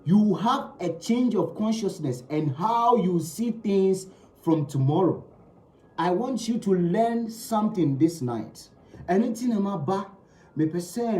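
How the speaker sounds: ordinary talk, nothing marked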